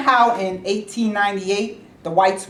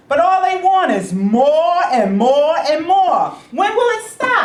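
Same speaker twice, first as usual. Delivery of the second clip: exasperated; 6 semitones higher, 3 dB louder than usual. Acted. Boss fed up with labor demands.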